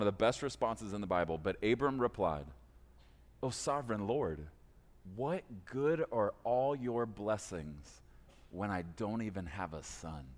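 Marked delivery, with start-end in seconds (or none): 3.4-10.2 soft reading. Acted. Reads Abram's reply from Genesis.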